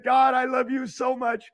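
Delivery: ordinary delivery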